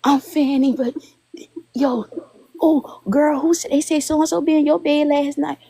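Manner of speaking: put-on high, far-off gossipy girl; gossipy fan voice.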